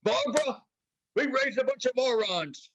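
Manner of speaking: high, hammed-up fatherly rant; mock-angry dad voice; imitating exasperated dad. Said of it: "He's like".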